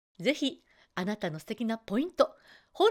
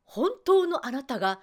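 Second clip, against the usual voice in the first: usual speaking voice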